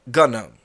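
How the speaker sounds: GNOME as two syllables, guh-NOME; hard G before the N in gnome; gnome with sounded G. Retold or imitated. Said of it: "we should all start calling it".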